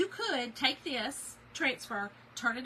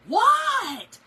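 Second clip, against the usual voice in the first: high, loud, startled What; loud baffled husband; 12 semitones higher, 14 dB louder than usual. Retold or imitated. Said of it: "Like, loud".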